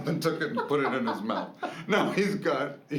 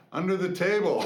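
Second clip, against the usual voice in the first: son's answer, higher and louder through laughter; laughing answer; 7 semitones higher, 6 dB louder than usual. Retold or imitated.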